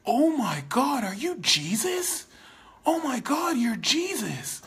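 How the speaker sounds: high, loud amazed crowd voice; breathless starstruck fan; awestruck gasp. Retold or imitated. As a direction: gush like you've met a saviour. People amazed, mistaking you for Jesus.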